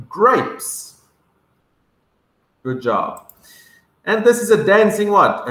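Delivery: usual speaking voice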